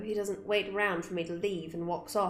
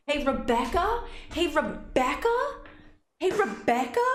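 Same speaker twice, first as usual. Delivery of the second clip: calling out; 8 semitones higher, 4 dB louder than usual. Pretend. Repeated calling, building.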